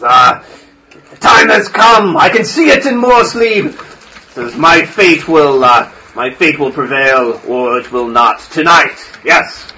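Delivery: put-on an old priest taking up his sword; grave old priest.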